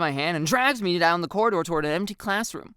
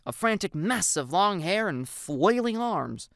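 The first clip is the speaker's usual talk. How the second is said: usual speaking voice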